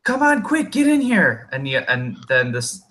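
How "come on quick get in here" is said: very high-pitched, urgent kobold voice; second kobold. Acted. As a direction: squeak it urgently to hurry a comrade inside. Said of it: "and then another voice saying".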